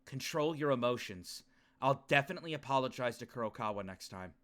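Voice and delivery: low, soft dramatic reading; hushed, low self-talk; somber inner monologue. Acted. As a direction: read it as troubled private thoughts, pitched low. Reads the character's inner monologue.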